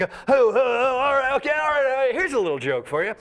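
high, flustered placating; high mock-panicked appeasing; nervous backpedal. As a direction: scramble to calm the mob. Nervous comedian placating crowd.

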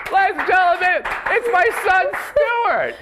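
high, loud showman's introduction; excited hollered introduction; big announcement. Real, not acted. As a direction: shout it out to the crowd, building to a long "Hey!". Announcing his son to the audience.

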